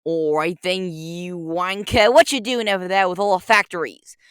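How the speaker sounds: put-on British accent, taunting the Lorax. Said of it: "We must unleash the British Walrus Detective".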